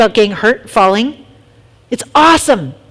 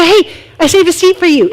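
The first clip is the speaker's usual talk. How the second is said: cheerful greeting; 7 semitones higher, 4 dB louder than usual. Retold or imitated. Friendly greeting voiced.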